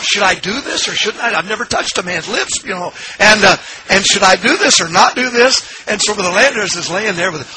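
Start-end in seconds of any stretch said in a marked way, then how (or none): 0.0-5.8 high-pitched mock dithering; retold agonized indecision. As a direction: act out a panicky inner debate. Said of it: "literally trying to figure out".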